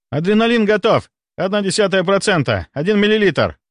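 high, breaking-news readiness call; urgent report. A narrator's reading. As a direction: report to the boss in a rush. Junior rushes in reporting ready.

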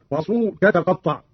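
ordinary talk

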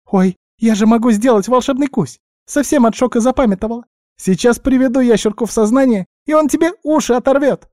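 high, squeaky, eager outburst; guinea pig suddenly remembering. A narrator's reading. Guinea pig threatening the elf with magic bite, excited squeak. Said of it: "тут же спохватилась".

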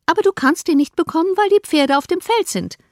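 mother refusing Jane the carriage, insistent; fussy mother. Acted.

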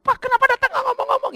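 very high, loud mock complaint; retold friendly protest. Family scolding the pastor for visiting unannounced, high loud protest. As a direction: imitate a host squealing in protest.

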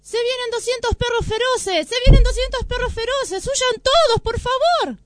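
high, loud panicked shout; gritando. A narrator's reading. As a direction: yell the alarm as if fleeing. (grito de pánico, agudo y fuerte)

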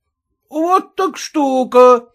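high, slow exclamation; exclaiming. A narrator's reading. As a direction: exclaim it, drawn out. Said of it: "воскликнул".

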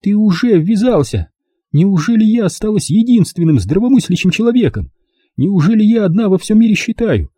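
high-pitched, insistent mother rant; outraged mother. Acted. Mother ranting about daughter being beaten, outraged. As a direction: appeal to them in high agitation.

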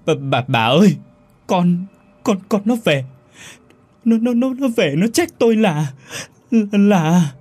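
high, loud, stuttering with terror; husband stammering to his wife after seeing the ghost, terrified. A narrator's reading. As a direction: teeth chattering, words tumbling out in panic. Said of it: "hàm răng va vào nhau lách cách".

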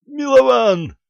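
high, drawn-out shout of a name; sudden high yell; urgent cry. Acted. Pensioner cries out to comrade.

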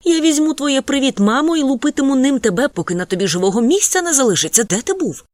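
high, loud furious threat; mother scolding her son for staying out. A narrator's reading. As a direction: scold him at full volume.

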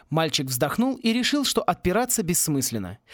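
ordinary delivery, nothing marked

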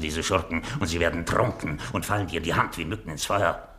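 ordinary talk

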